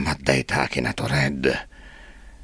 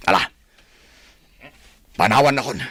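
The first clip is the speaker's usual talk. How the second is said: angry order; 11 semitones higher, 5 dB louder, much faster than usual. Acted. Boss orders Pingoy away.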